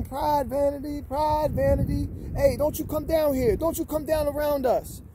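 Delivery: high, mocking chant of pride and vanity; mock sing-song taunt. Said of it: "y'all just singing".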